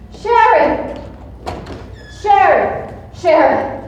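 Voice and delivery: loud, high excited call. Acted. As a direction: shout for him across the house. Calling out to Sherry.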